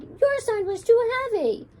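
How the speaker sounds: high, loud retort; shouting back. A narrator's reading. Simone shouts back at Meg after the crash. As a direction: throw the blame right back.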